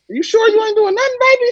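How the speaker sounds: put-on high, suspicious old-lady voice; sweetly doubting grandma, high; grandma checking the closet, high voice. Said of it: "She on some".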